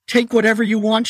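terrified gasp under the knife; gasping in fear. A narrator's reading. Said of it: "she gasped".

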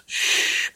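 whispered shush. Acted. Child shushing brother during Bible reading, whispered shush. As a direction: hush him sharply.

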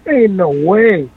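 slow, drawn-out retold refusal; emphatic refusal. Patient turning down a transfusion, retold emphatic refusal. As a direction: stretch each word to refuse outright.

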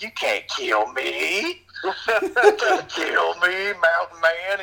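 high, drawn-out mock taunt; hammed-up, slow villain taunt; taunting movie villain. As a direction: imitate the psycho villain, stretching words into a rising singsong. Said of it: "He's just like".

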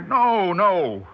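a startled no, then again; shocked denial. Acted. Told he attacked a colleague.

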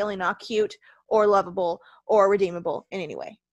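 normal talking voice

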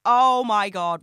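loud, startled outburst; sudden realisation. Real, not acted. To her listeners, remembering the trip, a loud startled outburst.

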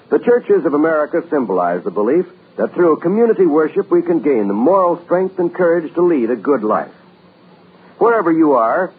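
usual speaking voice

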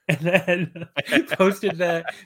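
amused, words broken up by laughter; laughing while talking. Real, not acted. Amused retelling of wrestler's video.

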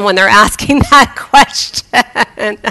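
slowing into a laugh on the words. Real, not acted. Preacher amused at people asking the wrong person, laughing. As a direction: let laughter break into the end.

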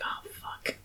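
barely audible whispered curse; whispered dismay. Acted. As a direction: whisper it as a dawning realization. Romeo realises she is a Capulet.

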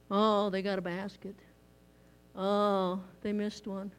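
lightly deadpan the game reactions; mock flat fan. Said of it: "how they act".

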